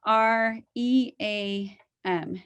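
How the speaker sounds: spells each letter out slowly and clearly; drawn-out letters, like dictating to a child; slow spelling out. Retold or imitated. Mother slowly spells the word for her daughter. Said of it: "And I said".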